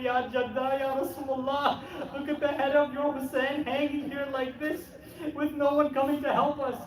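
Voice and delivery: high, loud grieving cry to the Prophet. Acted. Kulthum crying out to her grandfather at the gate, high anguished wail. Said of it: "begins crying out".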